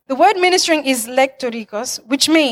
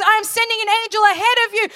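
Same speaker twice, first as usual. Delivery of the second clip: higher and fast, driving preacher's build; climactic preaching; 8 semitones higher, faster than usual. Acted. Pastor driving the congregation to the final climax. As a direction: pour it out fast and high to the close.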